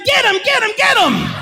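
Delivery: lightly voiced attacker; imitating the attacker. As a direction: play the attacker egging his friend on. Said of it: "he told his friend".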